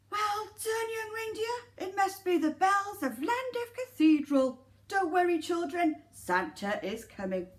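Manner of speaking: high, jolly Santa; lightly voiced cheerful Santa. Santa speaking.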